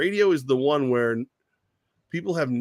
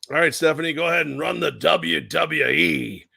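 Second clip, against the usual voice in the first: put-on bossy old boss; 3 semitones higher than usual. Boss handing Stephanie the company to run, bossy. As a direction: lay down the orders in a put-on boss voice. Acted as boss giving orders.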